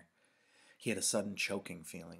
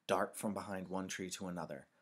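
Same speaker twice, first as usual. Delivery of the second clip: usual speaking voice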